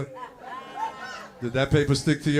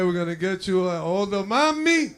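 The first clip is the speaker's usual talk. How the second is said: ordinary delivery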